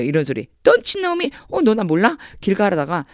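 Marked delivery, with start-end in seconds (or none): 0.6-1.3 Don't you know me? jumps much higher in surprise; surprised. Shown as a demonstration. Teacher acting out a friend on the street who ignores him, surprised. 1.5-2.2 surprised. Shown as a demonstration. Meeting a friend on the street.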